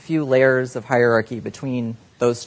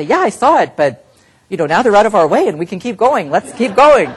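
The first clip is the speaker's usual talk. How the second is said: louder, higher, brushing the worry aside; dismissive boss; 9 semitones higher, 10 dB louder than usual. Pretend. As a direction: wave it off and press on. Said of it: "And the person might say".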